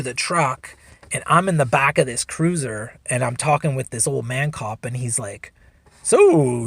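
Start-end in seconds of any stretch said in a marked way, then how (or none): 6.0-6.7 imitating a jovial old cop; an easy, friendly opener. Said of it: "he's all jovial and everything".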